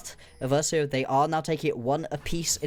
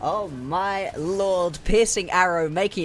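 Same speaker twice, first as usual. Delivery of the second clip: amazed; 5 semitones higher, 4 dB louder, slower than usual. Real, not acted. Caster reacts to big kill.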